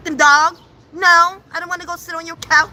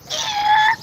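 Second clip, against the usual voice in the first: very high creaking yeah like a scraping car door; cartoon door squeal; squeaky door creak.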